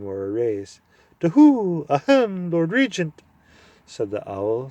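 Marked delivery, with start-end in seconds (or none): 1.2-3.2 high, loud owlish hoot of a voice. Acted. Owl hooting up at the deaf dwarf.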